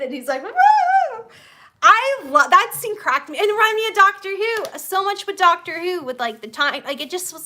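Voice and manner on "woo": very high, loud whoop; excited whoop. Retold or imitated. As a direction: imitate a giddy, excited "woo". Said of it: "and he gets excited".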